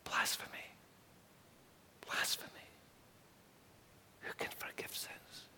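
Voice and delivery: hushed, secret whisper of doubters; whispered thought. Acted. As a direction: whisper it as a hidden accusation. Teachers' hidden thoughts voiced.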